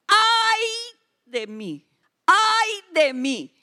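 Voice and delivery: lamento gritado, más agudo y fuerte, el último lento; grito de Isaías. Retold or imitated. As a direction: clama asustado ante la gloria de Dios. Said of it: "y gritó y dijo". (Isaías gritando de espanto, lento)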